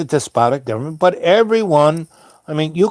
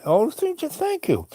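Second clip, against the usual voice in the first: much higher and softer, an overly grateful thank-you; gushing thanks; 13 semitones higher, 6 dB quieter than usual. Retold or imitated. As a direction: pitch it way up and soften it, gushing with relief. Thanking the driver.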